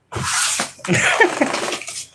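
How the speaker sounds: high, breathy giggle with no voice; high airy laugh. Real, not acted. Laughing after asking what Kenny wants to say, a high airy giggle. Laughing at her own question.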